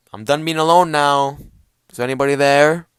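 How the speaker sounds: loud, high lonely call into emptiness. Retold or imitated. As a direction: call out to see who's there. Lonely man calling out for company.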